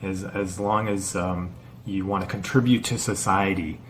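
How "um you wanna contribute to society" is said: usual speaking voice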